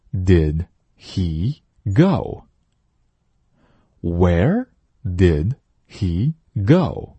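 Where did he go, each word drawn out; 慢速领读; very slow model read. Shown as a demonstration. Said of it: "第三句".

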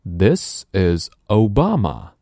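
very slow, this with a clear voiced th; slow model sentence; slow teaching read. Shown as a demonstration. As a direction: drill it slowly for learners.

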